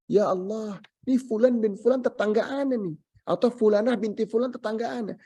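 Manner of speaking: a wronged neighbour protesting; accusing neighbour. Acted. Neighbour accusing on judgment day.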